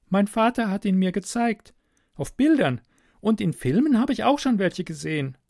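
put-on high, girlish voice; captive girl, matter-of-fact. Girl offers stranger coffee through the wall, high and matter-of-fact. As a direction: speak as an unbothered young girl, pitched high.

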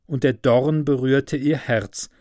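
plain reading voice

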